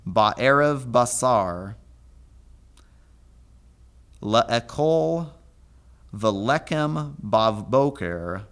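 measured scripture reading, word by word; slow Hebrew recitation. Acted. Teacher reading the Hebrew verse to students, slow and clear.

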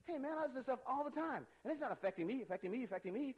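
mock teen kid. Kids claiming the music doesn't affect them, hammed-up teen voice. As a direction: ham up a teenager brushing it off. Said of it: "I have kids all the time telling me".

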